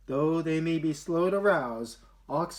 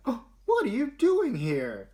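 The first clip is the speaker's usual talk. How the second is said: surprised; 9 semitones higher, 5 dB louder than usual. Acted. May surprised to see Olivia.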